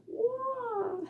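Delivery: high mock karate cry, softly done; a playful fake kung fu shout; silly karate yell. Sign teacher clowning for viewers, mock karate yell. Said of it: "I'm just kidding. I'm being silly".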